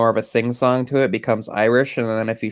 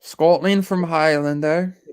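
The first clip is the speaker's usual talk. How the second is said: slow, higher put-on Scottish accent; comically shaky attempt at a Scottish accent; 5 semitones higher than usual. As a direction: try a drawn-out Scottish lilt that comes out funny. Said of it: "I told you it sounds funny if I try to do it".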